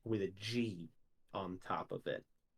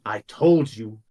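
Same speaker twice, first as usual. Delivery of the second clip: angry retort; 3 semitones higher, 8 dB louder, slower than usual. Acted. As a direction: bite back at the accuser. Heated argument.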